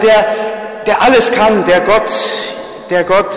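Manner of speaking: ordinary talk, nothing marked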